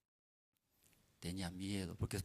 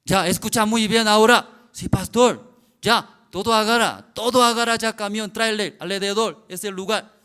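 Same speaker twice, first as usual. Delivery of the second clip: loud, high commanding call; pastor's loud orders; 9 semitones higher, 18 dB louder than usual. Retold or imitated. Pastor orders workers to lift the trailer, shouting. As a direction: shout the orders across the yard.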